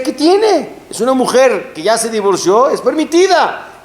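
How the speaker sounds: high, indignant protest; defiant protest. Retold or imitated. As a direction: pitch it up, insisting it's allowed. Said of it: "Y él decía".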